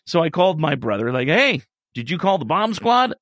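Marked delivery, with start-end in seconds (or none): 1.3-3.1 jokey. Retold or imitated. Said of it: "jokey, laughy".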